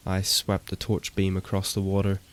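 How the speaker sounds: plain reading voice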